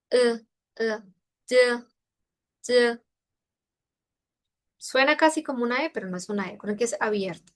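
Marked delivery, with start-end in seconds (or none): 0.1-3.0 "E" kept open and back, never the Spanish e; Chinese e, not Spanish. Shown as a demonstration. Teacher warning students off the Spanish e. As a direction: stay off the Spanish e. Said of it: "Procurar no caer en la E".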